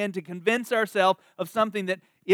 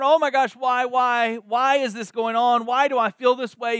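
higher, louder, distressed whining why; anguished self-talk; 5 semitones higher, 4 dB louder than usual. Retold or imitated. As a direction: fret out loud at your own feelings. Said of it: "the person is sitting there going".

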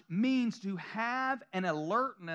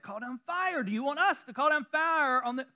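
put-on Southern accent; 5 semitones higher, 3 dB louder than usual. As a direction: say it like a Southern preacher. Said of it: "my southern accent came out real big".

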